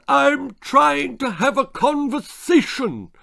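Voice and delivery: slow, very high, loud howl; howling mother. A narrator's reading. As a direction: wail it out in exasperation. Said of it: "His mother howled".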